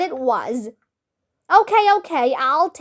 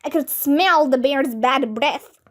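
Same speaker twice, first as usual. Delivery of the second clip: plain reading voice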